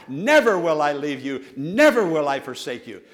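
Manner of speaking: loud proclamation, hitting each "Never"; loud scripture quoting. Shown as a demonstration. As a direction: quote the verse out loud and forcefully. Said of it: "Let it come out verbally, loudly".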